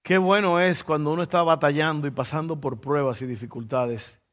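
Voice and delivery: normal talking voice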